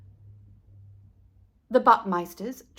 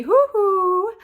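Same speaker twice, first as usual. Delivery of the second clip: yodel-like high voice; 9 semitones higher, 9 dB louder than usual. Acted.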